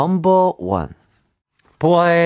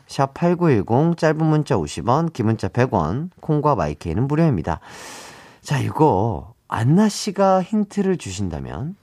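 ordinary talk

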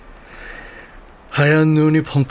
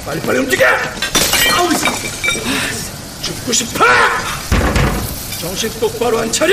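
harsh barking commands; 7 semitones higher than usual. Acted. Chef yelling at staff.

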